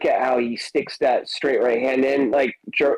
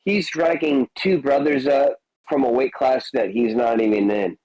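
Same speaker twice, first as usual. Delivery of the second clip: ordinary talk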